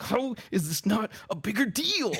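high, exasperated outburst; exasperated complaint. Retold or imitated. Guest grumbling while scraping paint, exasperated. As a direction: raise the pitch in mock disbelief. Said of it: "I'm just muttering, like".

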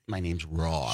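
"Rob" low, with a rounded aw vowel; forced "Rob". Retold or imitated. Man repeating his name as told, "Rob" said as "Rawb". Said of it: "So now I have to say Rob".

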